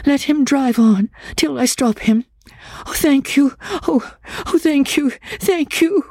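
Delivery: high, hurried woman's voice, grateful; a frightened woman pressing her thanks; high, eager pleading thanks; breathless eager woman. A narrator's reading. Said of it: "She spoke with breathless eagerness".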